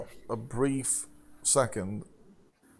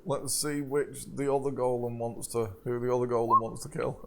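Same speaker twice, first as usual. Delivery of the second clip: ordinary talk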